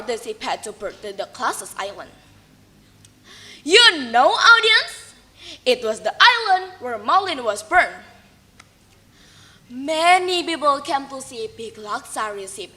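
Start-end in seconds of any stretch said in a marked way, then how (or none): none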